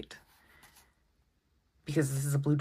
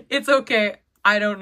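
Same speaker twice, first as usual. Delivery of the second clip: louder and higher through a laugh; 9 semitones higher, 10 dB louder than usual. Real, not acted. The cards don't fit, laughing it off. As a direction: laugh it off as you speak.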